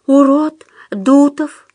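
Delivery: high, slow obedient voice; cowed, careful repetition; meek self-correction. A narrator's reading. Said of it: "исправился тот".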